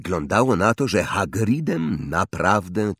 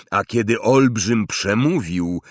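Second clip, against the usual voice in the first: plain reading voice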